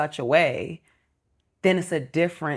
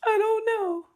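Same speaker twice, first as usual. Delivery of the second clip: put-on much higher little-kid voice; imitating son. Mother mimics her son's answer, in a high kid voice. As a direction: squeak it out like a sulky child. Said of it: "Why are you crying?".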